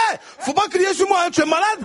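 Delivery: loud, high indignant retort; Ivorian side flares. Acted. Comic answering the shouting guard, loud and indignant. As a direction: snap back, offended. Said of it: "mon côté ivoirien qui est remonté".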